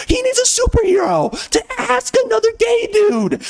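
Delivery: very high, crying mocking whine; mock whiny falsetto. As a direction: parody a sniveling fan in shrill falsetto. Mocking modern writers' stories.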